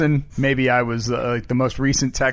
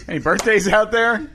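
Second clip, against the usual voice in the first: raised pitch, calling out to the audience; crowd-work comic; 7 semitones higher than usual. Acted. Comic asking the Broadway crowd, high showy call. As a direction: work the room like a hammy stage comedian. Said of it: "it's Broadway comedy".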